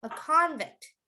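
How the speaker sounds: "convict" with strong CON; stress on first syllable. Shown as a demonstration. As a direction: lean on the start of "convict". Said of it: "Now, how would you say the verb".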